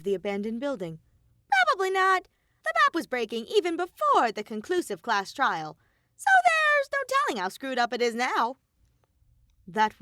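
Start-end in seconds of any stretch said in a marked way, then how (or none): 1.5-8.6 high, loud cartoon bear voice; louder, higher bratty bear mascot; Monokuma voice.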